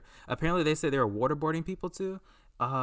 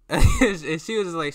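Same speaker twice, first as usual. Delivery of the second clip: laughing; 5 semitones higher, 9 dB louder, slower than usual. Real, not acted. Laughing while retelling.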